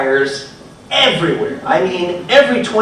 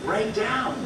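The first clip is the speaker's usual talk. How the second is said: soft quote; 3 semitones higher, 9 dB quieter than usual. Retold or imitated. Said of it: "In the immortal words of [name]".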